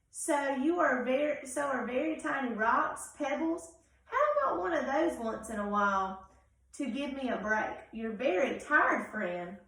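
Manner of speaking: loud, weary gray crayon; an overworked crayon begging for a break; exhausted crayon. Acted. Reads Gray Crayon's letter.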